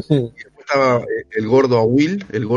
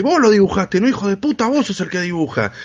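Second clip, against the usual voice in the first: angry accusation; 8 semitones higher than usual. Retold or imitated. Big kid accusing the narrator, higher mock anger. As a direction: retell the kid's angry blame. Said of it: "vino así enojado a mí".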